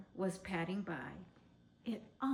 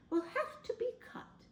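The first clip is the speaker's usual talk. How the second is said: Miss Adelaide; 14 semitones higher than usual. A narrator's reading. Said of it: "said Miss Adelaide".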